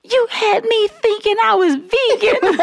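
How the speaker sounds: slow, high, laughing mom accusation. Retold or imitated. Mom to daughter, slow high laughing accusation. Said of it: "my mom came to me like".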